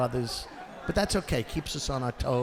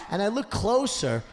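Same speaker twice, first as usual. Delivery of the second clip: normal talking voice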